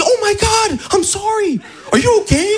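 voix très aiguë d'Américain confus; excuses affolées. Acted. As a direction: se confondre en excuses, haut perché. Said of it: "on dit". (excuses paniquées jouées, très aiguës)

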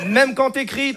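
normal talking voice

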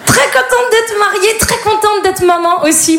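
high, slow, syrupy happy mum voice; sugary delight. Pretend. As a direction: gush like a perfect mother, overly sweet. Ton exagérément enjoué. (voix de maman ravie, aiguë et sucrée)

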